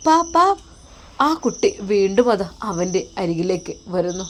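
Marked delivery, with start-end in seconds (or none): 0.1-0.5 put-on high, louder little child's call of പാപ്പാ; toddler calling papa. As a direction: call out like a tiny child to his dad.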